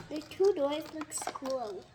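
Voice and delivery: usual speaking voice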